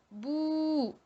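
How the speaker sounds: bou held twice as long; long vowel. Shown as a demonstration. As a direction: draw the ou of bou out to double length. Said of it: "deux fois plus long qu'une voyelle brève".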